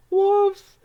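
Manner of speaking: high, loud warning cry; alarmed shout. Acted. Warning the hiding animals, high loud alarm. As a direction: sound the alarm for the little animals.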